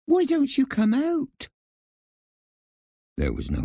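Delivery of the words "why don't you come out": high mouse voice calling out; anxious Nutmeg. A narrator's reading. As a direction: coax the hidden speaker out, high-pitched. Mouse heroine calls to hidden voice.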